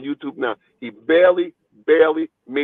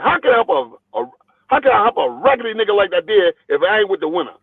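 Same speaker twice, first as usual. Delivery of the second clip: forceful promoter lecture; 6 semitones higher, faster than usual. Retold or imitated. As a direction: rattle it off with force, driving the point home. Promoter lecturing boxer, retold.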